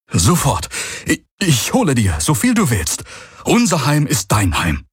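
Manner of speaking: breathless eager helper, high; eager servant. Acted. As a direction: jump to serve at once. Boy jumps up eagerly.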